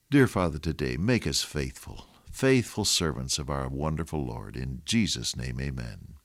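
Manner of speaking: soft, low prayer voice, fading to amen; bow the head and pray gently; closing prayer. Real, not acted. Pastor closes the sermon with a soft prayer.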